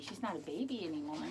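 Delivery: ordinary delivery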